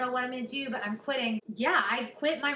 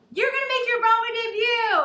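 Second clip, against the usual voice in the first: much higher and louder, bursting with news; thrilled announcement; 11 semitones higher, 9 dB louder than usual. Retold or imitated. Agents telling Kelly she got Broadway, thrilled. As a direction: deliver the big news triumphantly.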